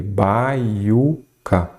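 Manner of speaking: "Baiuca" split into separate syllables; "Baiuca" in slow, separated beats. Shown as a demonstration. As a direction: break "Baiuca" apart slowly, pausing between syllables. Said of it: "Quando separa a palavra em sílaba".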